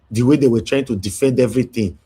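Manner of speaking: ordinary delivery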